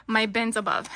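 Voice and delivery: ordinary talk, nothing marked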